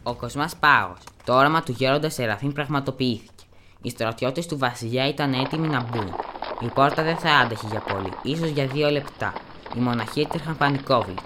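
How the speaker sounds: ordinary delivery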